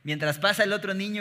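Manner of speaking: ordinary talk, nothing marked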